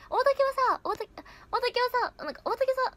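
high-pitched put-on voice; high, hesitant stab at someone's voice; attempted impression. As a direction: try the mimicry out in a high register. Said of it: "うん、難しいな".

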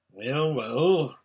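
lightly grumbled concession; mildly gruff royal grumble; grumbling. A narrator's reading. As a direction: grumble as a king giving way. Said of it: "grumbled the king".

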